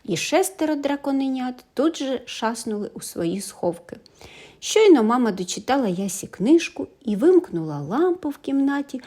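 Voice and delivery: plain reading voice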